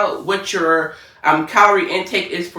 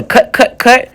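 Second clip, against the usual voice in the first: playful echo; 8 semitones higher, 8 dB louder, slower than usual. Real, not acted.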